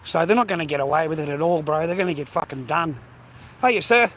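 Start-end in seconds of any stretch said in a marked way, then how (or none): 3.6-4.1 friendly call-out. Real, not acted. Greeting a passer-by.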